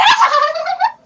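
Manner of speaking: very high, loud mock cackle; piercing chipmunk-like giggle; imitated girls' shriek. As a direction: squeal over the movie like giggling teens. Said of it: "and then the background".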